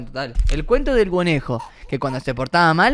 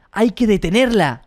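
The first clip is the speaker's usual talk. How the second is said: narrated shout; 6 semitones higher than usual. A narrator's reading. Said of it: "gritó".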